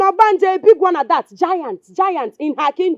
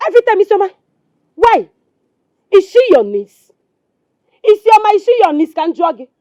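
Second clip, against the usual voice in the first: angry rant, raised and pushed; exasperated tirade; 2 semitones higher, 3 dB louder than usual. Acted. Wife fed up with husband defending Isioma, exasperated. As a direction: fire off complaints, voice raised.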